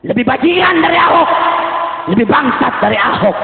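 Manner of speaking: shouted, high, rousing sermon cadence; rally the crowd with every line; fiery preacher at full voice; preaching at full force. Acted. Sermon condemning Ahok's defenders.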